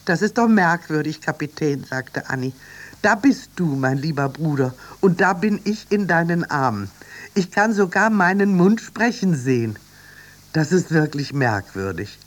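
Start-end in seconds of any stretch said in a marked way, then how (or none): 0.0-1.8 lightly voiced Annie, higher and louder; a hint of wonder in a raised figure voice; Annie, puzzled. 3.0-12.2 raised, louder voice full of wonder; higher-pitched, wondering character voice; Annie, marvelling. Acted.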